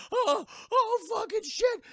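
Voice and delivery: high, loud gasping panic; mock freak-out. Girl sees the skeleton crew, mock panic. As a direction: imitate someone freaking out, hammed up. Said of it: "just freaking the fuck out".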